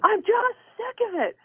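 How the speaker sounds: very high exasperated outburst; shouting. Retold or imitated. Cashier to customer, fed up, shouting. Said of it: "she's shouting at me".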